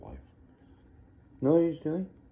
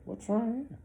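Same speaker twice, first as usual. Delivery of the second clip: high, incredulous comeback; incredulous retort. Retold or imitated. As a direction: fire it back in disbelief.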